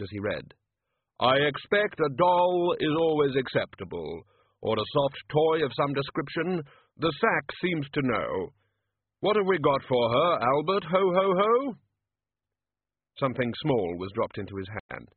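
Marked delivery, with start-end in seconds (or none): 1.2-11.7 Death voice. Acted.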